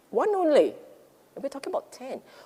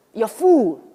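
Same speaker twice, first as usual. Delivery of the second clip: loud, firm declaration; firm inner voice; 10 dB louder than usual. Pretend. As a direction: mind insisting you're full. Mind telling you you're full.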